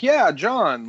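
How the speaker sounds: high-pitched imitation of a cheering supporter; mock cheer. Host imitating everyone backing Jon as commander, mock cheering.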